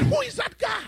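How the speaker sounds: high shouted challenge from the giant; booming Goliath. Acted. Giant taunting the fearful army, shouting high. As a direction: bellow it out like a mocking giant.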